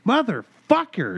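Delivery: sudden high yelled curse. Retold or imitated. Recalling the dishwasher mishap, a loud high curse. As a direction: blurt it out like the tab just crumbled. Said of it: "and I go".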